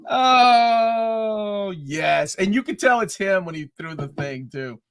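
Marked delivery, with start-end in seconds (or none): 0.1-2.3 joyful cry. Retold or imitated. Fan reliving Blade's entrance.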